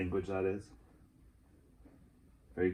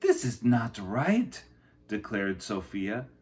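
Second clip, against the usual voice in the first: put-on lively read-aloud for children; picture book reading; 5 semitones higher, 9 dB louder, slower than usual. A narrator's reading. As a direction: tell the story to a class.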